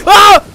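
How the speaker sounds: high, loud scared yelp; jump-scare shriek; startled scream. Real, not acted. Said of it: "I don't know why I got scared".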